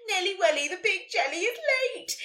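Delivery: high, loud playground jeer; yelled taunt. A narrator's reading. Ned jeering at Nelly in class, yelled taunt. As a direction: yell it out high like a teasing schoolboy. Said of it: "he yelled".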